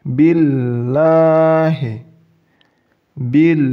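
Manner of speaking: billahi, very slow, thin held "ll"; light lam. Shown as a demonstration. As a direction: recite billahi slowly, alif skipped. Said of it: "Langsung masuk ke lam tasdid".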